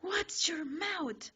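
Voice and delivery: sharp, slow whisper; scolding whisper. A narrator's reading. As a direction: hiss the scolding slowly. Ida scolds the swearing.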